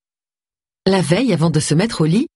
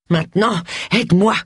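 acted breathless order, pitched up; panting; 3 semitones higher than usual. A narrator's reading. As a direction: give the order as if winded. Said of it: "haleta Missus Tripp". (haletante, en jouant l'essoufflement)

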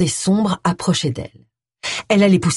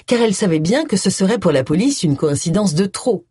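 ordinary delivery

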